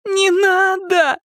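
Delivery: high, drawn-out plea; tearful begging. A narrator's reading. Daughter begs father not to dump packet, tearful. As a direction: beg as if about to cry. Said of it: "взмолилась она и расплакалась".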